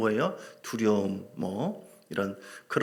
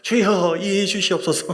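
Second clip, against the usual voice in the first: apologetic cry; 7 semitones higher, 8 dB louder than usual. Retold or imitated. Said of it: "주님께 미안해서".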